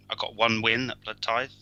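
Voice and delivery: usual speaking voice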